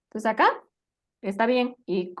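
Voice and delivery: normal talking voice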